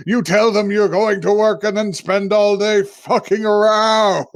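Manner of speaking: high-pitched possessed-father tirade; hammed-up accusing rant; mock angry scolding. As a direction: berate the son, voice pushed up high. Spirit father berating his son.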